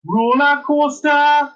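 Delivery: high, slow, hammed-up Geordie; Geordie accent. Shown as a demonstration. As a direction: lead the room in high-pitched Geordie. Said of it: "the Geordie accent".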